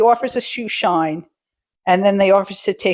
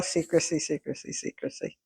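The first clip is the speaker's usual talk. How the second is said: each secrecy softer until whispered; fading whispered echo; 6 semitones lower, 13 dB quieter than usual. Retold or imitated. Monkeys echoing secrecy, fading into a whisper. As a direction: trail off to a whisper.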